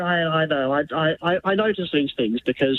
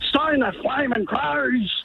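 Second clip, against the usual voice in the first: slow, raised-pitch take on a gruff Aussie bloke's catchphrase; Alf impression; 6 semitones higher, slower than usual. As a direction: drawl the old catchphrase slowly, pitched up. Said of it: "Alf from Home and Away was so fond of saying".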